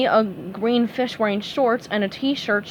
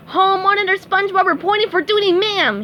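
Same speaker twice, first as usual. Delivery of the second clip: quick, high cartoon sergeant bark; drill sergeant voice; 9 semitones higher, 4 dB louder than usual. A narrator's reading. SpongeBob salutes his teacher in a fast drill-sergeant voice. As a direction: report like a soldier at attention. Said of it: "in a drill sergeant voice".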